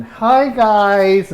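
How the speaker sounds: chirpy mock advert greeting. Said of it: "uh, ads voice and".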